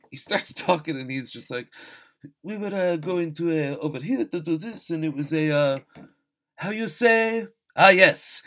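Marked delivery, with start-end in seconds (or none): 2.4-8.4 put-on broad, higher Mario-style Italian accent; bad Italian accent, groping for the English word; Jeremy Irons, Italian accent. Podcaster mocking an actor's improvised lines, hammy Italian accent. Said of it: "my Italian accent is not gonna be great".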